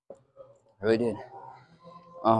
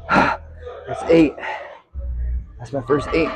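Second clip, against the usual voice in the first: strained shout; 4 semitones higher, much slower than usual. Real, not acted. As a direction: push the words out at full effort. Last rep of set.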